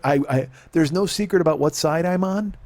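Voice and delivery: ordinary talk, nothing marked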